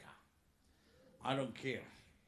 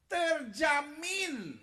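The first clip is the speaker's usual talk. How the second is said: slow, drawn-out, louder and higher; emphatic shout; 9 semitones higher, 6 dB louder, much slower than usual. Real, not acted. Preacher proclaiming the answer to the church, shouting slowly. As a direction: proclaim it to the congregation.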